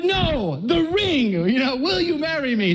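high insistent retold plea; exasperated correction. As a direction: steer her back to the ring, half laughing. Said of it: "I'm like".

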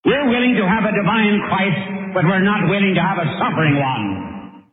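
high, indignant refusal; protesting Peter. Acted. Preacher voicing Peter refusing a suffering Christ, high protest. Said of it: "And Peter said".

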